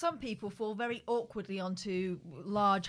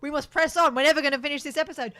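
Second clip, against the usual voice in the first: loud, fast, bossy impression; mock pompous; 6 semitones higher, 7 dB louder than usual. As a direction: rush it like a bossy host. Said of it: "when I do that".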